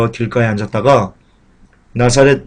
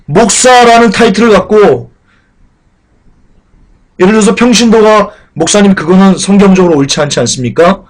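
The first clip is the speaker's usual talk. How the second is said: high, loud pulpit fervor; impassioned preaching; 7 semitones higher, 5 dB louder than usual. Acted. Pastor urging the congregation, fervent preaching. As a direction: preach it hard to the pews.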